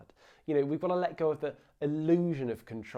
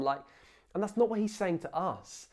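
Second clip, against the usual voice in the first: usual speaking voice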